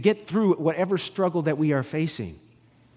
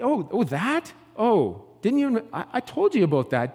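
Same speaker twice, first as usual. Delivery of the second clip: normal talking voice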